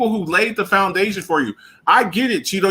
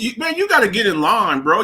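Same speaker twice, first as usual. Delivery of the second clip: normal talking voice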